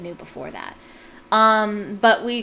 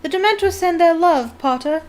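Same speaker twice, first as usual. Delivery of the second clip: loud, higher taunting yell across the crowd; mocking shout; 8 semitones higher, 8 dB louder than usual. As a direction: shout it spitefully through the hall. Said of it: "who shouted through the crowd".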